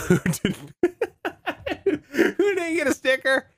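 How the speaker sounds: high, slow words through laughter; real laughing. Real, not acted. Host apologizing to anyone in Russia, laughing. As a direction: crack up mid-apology.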